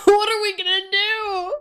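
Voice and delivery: high fast panicked wail; panicked cry. Real, not acted. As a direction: cry out in alarm. Panic as tank is possessed.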